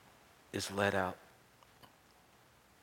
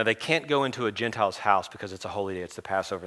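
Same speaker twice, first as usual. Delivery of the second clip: ordinary talk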